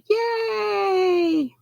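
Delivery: long, high-pitched yay; happy cheer. Real, not acted. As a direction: draw out a high celebratory cheer. Cheers scarf finally reaching its owner.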